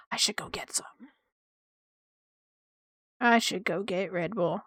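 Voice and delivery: soft unvoiced whisper to yourself; quiet whisper aside. Real, not acted. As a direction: mutter it under your breath. Muttered aside to self.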